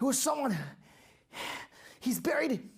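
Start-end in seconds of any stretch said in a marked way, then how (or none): none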